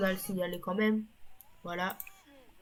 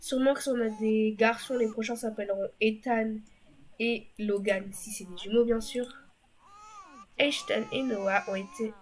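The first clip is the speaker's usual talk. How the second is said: ordinary talk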